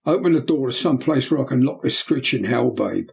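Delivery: usual speaking voice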